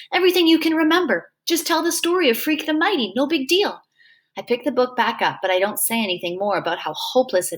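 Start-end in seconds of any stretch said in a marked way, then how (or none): 0.1-3.8 encouraging. Acted. Freak encouraging, high.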